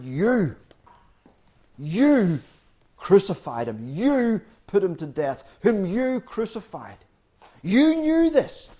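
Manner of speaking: YOU hit hard, high and drawn out each time; emphatic YOU. Retold or imitated. Preacher quoting Peter's charge to the crowd, emphatic YOU. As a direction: lean heavily on every YOU, accusingly. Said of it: "really emphatic with his word".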